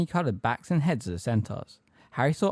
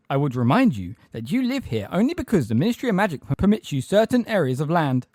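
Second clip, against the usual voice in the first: raised, officious reminder; indignant; 6 semitones higher, 5 dB louder than usual. A narrator's reading. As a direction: lecture them indignantly in a higher voice. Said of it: "with indignation".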